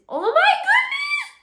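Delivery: very high, loud, stretched shriek; delighted squeal. Real, not acted. Delighted on seeing the gift.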